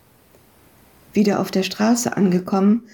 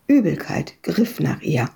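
plain reading voice

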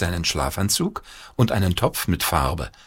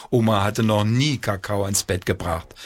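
ordinary delivery, nothing marked

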